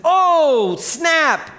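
high, loud re-enacted yelp; startled yell. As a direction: yell it like you jumped in shock. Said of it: "And she yells".

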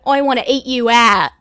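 put-on loud, broad Australian accent; mock Australian guy. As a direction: imitate an Australian man's come-on, loudly. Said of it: "I've had an Australian guy be like".

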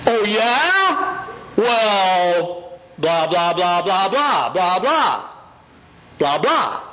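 hammed-up argumentative babble; mock retort. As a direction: fire back defensively in blah-blahs. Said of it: "say all that yucky stuff".

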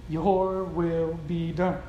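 low, soft, drawn-out surrender; hushed, slow prayer of acceptance; quiet submission. Acted. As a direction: yield slowly in a low, soft voice. Preacher repeats the faithful response softly.

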